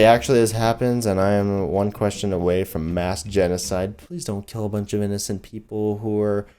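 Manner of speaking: normal talking voice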